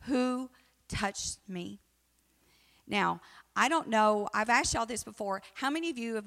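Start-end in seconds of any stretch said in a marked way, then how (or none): 0.0-1.8 slow, searching question; a slow, drawn-out who touched me; Jesus asking slowly. Retold or imitated. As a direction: draw out each word as Jesus, wondering. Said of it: "he asked in verse three".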